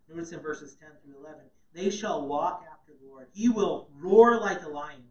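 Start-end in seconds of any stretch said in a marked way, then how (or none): 1.7-5.1 proclaiming. Acted. Preacher reads the Lord's roar, loud and slow. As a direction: roar builds louder and slower. Reading scripture about roaring.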